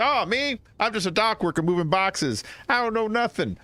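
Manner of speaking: high-pitched New York dock worker; New Yorker. Acted. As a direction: play an innocent witness in a New York accent. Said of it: "I talk like a New Yorker".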